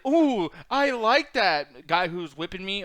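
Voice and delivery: slow, lightly put-on voice of a cartoonish character; mock delight. Parodying an imagined slavery movie, slow mock-delighted voice. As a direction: draw it out in pretend relish.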